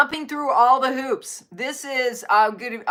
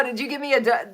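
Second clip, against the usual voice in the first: normal talking voice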